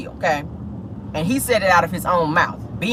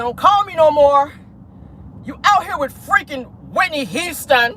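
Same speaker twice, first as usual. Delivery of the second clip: high, mocking Valley girl; 6 semitones higher than usual. Mimicking her phone rant in a high Valley girl voice. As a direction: put on a higher Valley girl whine of outrage. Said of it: "She was talking in her Valley, her, her Valley girl voice".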